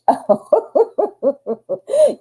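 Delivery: high, bright burst of laughter at the card; delighted laugh. Real, not acted. Excited reveal of a good card.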